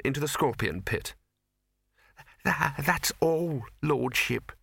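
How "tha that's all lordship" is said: slow, stammering servant whine; nasal whine. Acted. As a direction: whine it out, cowering. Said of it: "he whined".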